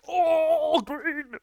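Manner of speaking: mimicking a streamer's excited outburst; mock excited cry. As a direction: squeal "oh, green" as if on stream. Mocking T90 stream reaction.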